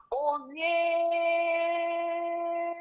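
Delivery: long high-pitched held call; dream cry. Retold or imitated. Caller retelling her cry from an attack dream, drawn-out call. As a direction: draw it out high, as if calling skyward. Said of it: "you hear me in the dream saying".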